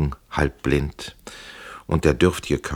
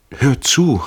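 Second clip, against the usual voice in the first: high, louder call to listen; 10 semitones higher, 6 dB louder than usual. A narrator's reading. Prisoner tells her to listen, calling out. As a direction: call up to someone out of sight. Prisoner calls to woman outside.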